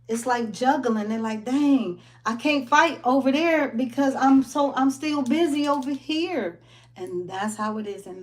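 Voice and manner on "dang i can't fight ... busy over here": higher, harried voice of an overworked body; frazzled immune system. Pretend. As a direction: whine it like a busy worker.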